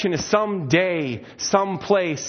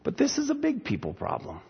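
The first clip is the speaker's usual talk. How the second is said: patronizing Martha; 3 semitones higher, 4 dB quieter than usual. Retold or imitated. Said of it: "And she's like".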